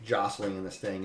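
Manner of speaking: ordinary talk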